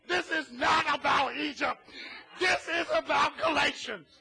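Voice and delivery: loud, fervent preaching. Acted. Pastor preaching to his congregation, loud and fervent. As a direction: preach it out hard to the pews.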